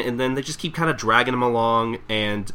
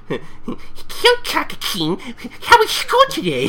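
very high put-on voice; high falsetto parody parent; high mock parent voice. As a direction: squeak it like a silly parent. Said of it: "comes home to his parents".

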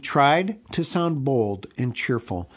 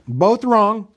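loud relieved cry; cried out; 9 semitones higher, 8 dB louder than usual. A narrator's reading. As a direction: call it out in relief. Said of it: "cried Bilbo".

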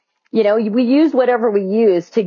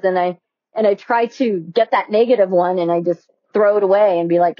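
ordinary talk

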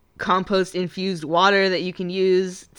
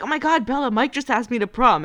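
gushing; 5 semitones higher than usual. Retold or imitated. Friend bursts in with news.

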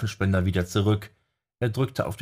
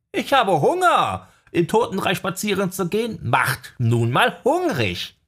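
whiny hungry owl; 11 semitones higher, 4 dB louder than usual. Acted. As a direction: whine it like a starving little owl. Owl character voice.

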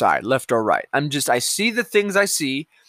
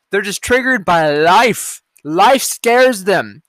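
very slow, louder, drawn out; slow emphatic scorn; 3 semitones higher, 5 dB louder, much slower than usual. Real, not acted. Vlogger mocking triggered opponents, slow and scornful. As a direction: spell it out slowly with contempt.

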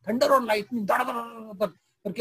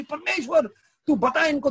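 high, louder call to God; loud cry; 7 semitones higher than usual. Acted. Old woman crying out to God before the leaders, high and loud. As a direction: cry out to heaven, pleading to be backed up. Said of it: "वो जोर से चिल्लाई".

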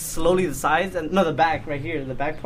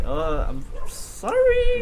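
slow, higher, sheepish mock apology; 7 semitones higher, much slower than usual. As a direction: draw it out like a guilty kid. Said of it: "and I was like".

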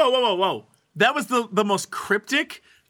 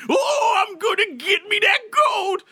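high, cracked grizzled miner holler; old prospector; 13 semitones higher than usual. Retold or imitated. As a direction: yell like an old gold miner. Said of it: "he walked around the street going".

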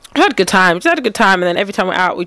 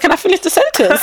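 higher-pitched protest at being cut off; mock protest; 9 semitones higher, faster than usual. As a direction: retell an indignant interruption, pitched up. Acted protest in retold exchange.

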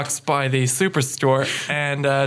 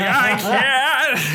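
mock anguished Ghost Rider; 10 semitones higher than usual. Ghost Rider voiced with laugh.

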